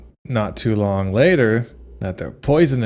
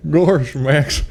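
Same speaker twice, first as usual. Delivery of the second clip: high laughing Goofy voice; goofy cartoon dog voice; Goofy impression; 5 semitones higher, 4 dB louder than usual.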